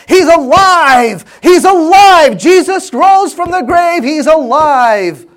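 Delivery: full-force high yell, drawn out. Retold or imitated. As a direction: burst out like a child who can't take it anymore. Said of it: "and she yelled out".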